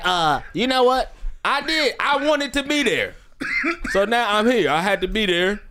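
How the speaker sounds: high, chirpy alien voice; eager alien dropping by. Pretend. Voicing the alien visitor.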